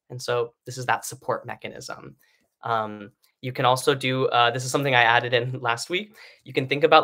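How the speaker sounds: ordinary talk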